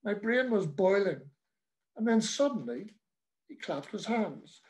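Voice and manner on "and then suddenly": ordinary delivery, nothing marked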